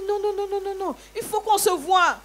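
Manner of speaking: high, loud rapid nons; imitating an angry landlady, high and loud; retold angry insistence. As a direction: hammer home the meeting demand. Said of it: "c'est des cris que j'entends".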